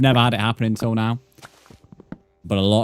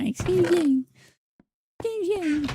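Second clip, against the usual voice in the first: much higher squeaky mocking voice; voice impression. Streamer mimics a chatter, high squeaky mocking voice. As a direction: mimic them in a prissy high pitch. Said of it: "I've just done a very good voice impression".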